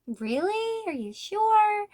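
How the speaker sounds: high, doubtful questioning; skeptical. Retold or imitated. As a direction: raise an eyebrow at the rumor. Doubting the gossip.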